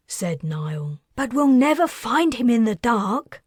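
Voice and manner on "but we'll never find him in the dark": usual speaking voice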